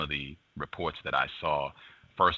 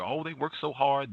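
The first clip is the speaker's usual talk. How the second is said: higher sarcastic mock praise; 8 semitones higher than usual. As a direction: mimic with a scornful lilt.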